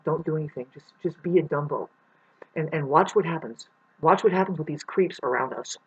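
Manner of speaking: low, hushed scheming voice; a lightly voiced conspiring father, murmured low; imagined dad's instructions. Dad quietly coaching his son to slack off, a low murmur. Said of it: "he pretty much said".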